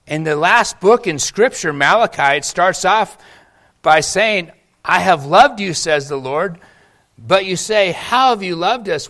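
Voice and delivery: loud, rising sermon voice; preaching. Real, not acted. Pastor urging his congregation on God's grace, preaching loudly. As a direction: preach it with full conviction. Preaching builds to full force.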